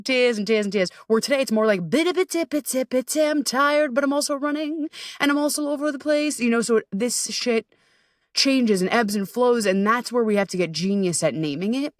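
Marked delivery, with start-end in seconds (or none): 1.9-6.4 high, quick, frazzled chatter of a mind all over the place; scattered anxious self. Retold or imitated. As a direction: rattle it off high and fast like a scattered brain. Voicing her scattered anxious self.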